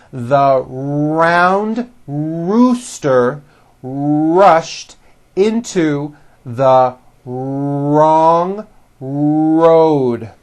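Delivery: very slow, stretching the R in "round rooster rushed" and "wrong road"; word by word, holding every opening R in "round rooster rushed"; exaggerated American R. Shown as a demonstration. Teacher has students repeat this sentence, slowly, "round rooster rushed" with long strong R's. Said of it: "pronouncing R's like this may feel a little weird".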